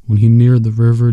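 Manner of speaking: ordinary delivery, nothing marked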